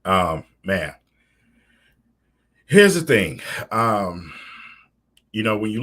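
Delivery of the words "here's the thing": loud emphatic. Real, not acted.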